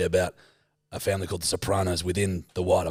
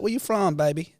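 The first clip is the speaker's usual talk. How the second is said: pitched up high for the auntie; Auntie's voice; 6 semitones higher than usual. Retold or imitated. Imitating the auntie asking where he's from, pitched high. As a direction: sing out her friendly question. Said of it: "She's like".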